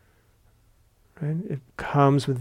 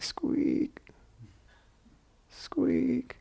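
imitated squeak; 12 semitones higher, 8 dB quieter than usual. Teacher imitating the floorboard in Burma, squeaking. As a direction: mimic a creaking board. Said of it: "kept hearing this".

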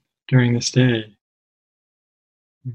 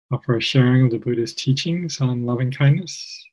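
usual speaking voice

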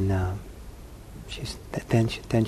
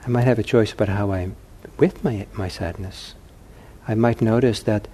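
ordinary talk

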